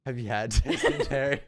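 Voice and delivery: laughing through the question; laughing repeat. Real, not acted. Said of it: "What'd you say?".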